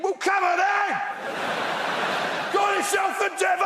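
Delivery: belligerent, high-pitched dare; defiant British challenge. Acted. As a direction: square up to the devil and yell. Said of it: "being British, are thinking".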